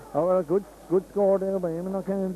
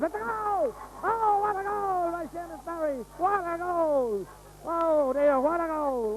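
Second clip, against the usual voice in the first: high, stretched goal call; long goal yell; 13 semitones higher, slower than usual. Real, not acted. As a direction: let the goal call ring out. Goal scored, commentator erupts.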